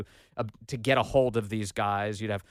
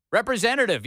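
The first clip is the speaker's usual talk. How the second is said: higher, louder mock yell at an automated line; mock phone scream; 8 semitones higher, 5 dB louder than usual. Caller yelling at the phone menu, a mild mock scream. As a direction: bark the word at the phone robot. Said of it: "you keep screaming into the phone".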